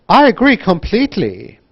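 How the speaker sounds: loud, high "agree", "completely" swooping down; big rises and falls on "I agree completely"; wide intonation. Shown as a demonstration. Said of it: "think about connected speech and intonation".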